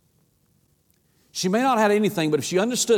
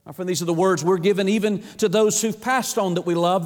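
ordinary delivery

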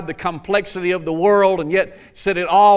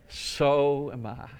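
soft, slow, lowered punchline; understated missionary; 3 semitones lower, 7 dB quieter, much slower than usual. Acted. Preacher repeating missionary's answer to church, slow quiet reply. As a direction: let it land quietly. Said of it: "And the missionary says".

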